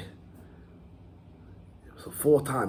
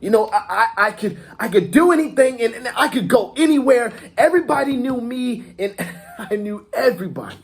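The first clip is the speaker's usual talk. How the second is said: high, loud, tumbling excitement; exhilarated boast; 9 semitones higher, 3 dB louder than usual. Acted. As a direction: boast like the luckiest kid alive. Acted monologue, luckiest kid boasting.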